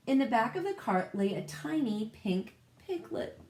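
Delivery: plain reading voice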